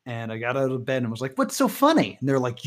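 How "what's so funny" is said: higher-pitched retold child's question; curious kid, lightly imitated; imitating curious kid. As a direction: lightly voice your younger self, pitched up. Child asking parents in retold story.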